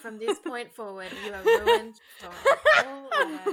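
hammed-up solemn vow collapsing into laughter; laughing mock vow. Friend mock-reciting wedding vows, laughing and rising.

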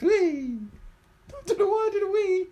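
very high, fast squeal. Real, not acted. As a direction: squeal and giggle through it. Squeal while being tickled.

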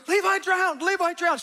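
high, loud panicked cry; frantic girl shouting it; shrieking alarm. Retold or imitated. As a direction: yell the news inside like a siren. Daughter shrieking to mom.

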